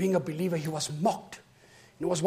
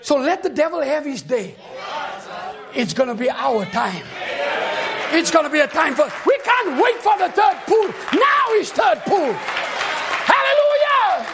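loud, high, shouted sermon climax; full-force preaching; 10 semitones higher, 7 dB louder than usual. Acted. Preacher shouting praise to the congregation, full force. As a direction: preach it out, rising with each line.

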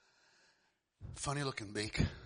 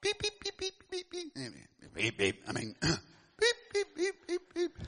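chick peeps; slower than usual. Acted. Chicks beeping at convention.

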